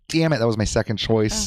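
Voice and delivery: hammed-up annoyed outburst; mock frustration. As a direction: curse a near miss in playful exasperation. Mock medium missing a name guess.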